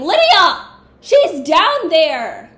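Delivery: loud, high shout across the battlefield; calling out. Real, not acted. Player shouting to Lydia in battle, loud high call. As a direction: yell to warn her, slow and urgent.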